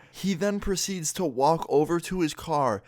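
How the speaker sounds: normal talking voice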